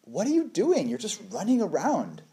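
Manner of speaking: playful exasperation, high-pitched; exasperated parent. Retold or imitated. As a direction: ask the toddler in mock disbelief what he's up to. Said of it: "sometimes I'm just like".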